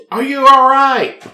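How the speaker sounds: high, loud, slow call into the dark; retold yell. Woman yelling into the night at a scream, high, loud and slow. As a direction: call out as if someone is hurt outside. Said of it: "I yelled".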